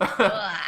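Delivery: loud throaty "ugh"; throaty noise. Shown as a demonstration. Co-host demonstrates the Cockney sound, throaty. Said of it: "It's, like, really throaty".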